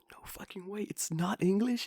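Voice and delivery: hushed high whisper opening up; imitated whispered shock; stunned disbelief. Teacher finding out it isn't English, stunned high whisper. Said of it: "It was really funny when she found out".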